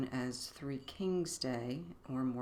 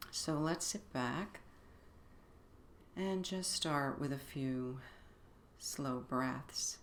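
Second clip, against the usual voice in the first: guided meditation; 4 dB quieter than usual. Acted. Begins leading the meditation.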